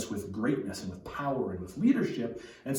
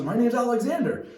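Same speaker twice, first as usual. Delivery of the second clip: high, louder mock-tearful plea; 10 semitones higher, 5 dB louder than usual. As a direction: blurt it out like a boy near tears. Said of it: "tears in his eyes".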